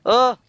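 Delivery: short, high, loud "oh"; startled yelp. Real, not acted. Gamer reacting to teammate's near accident, startled yelp. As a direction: yelp in sudden alarm.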